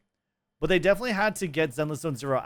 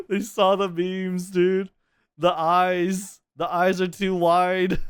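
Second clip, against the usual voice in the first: laughing; 5 semitones higher, 5 dB louder, much slower than usual. Real, not acted. Laughing reaction to the meme fix.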